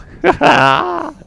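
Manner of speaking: loud, high-pitched cackle; old crone's cackle. Acted. Old seer laughs at the doom she foretells, loud high cackle.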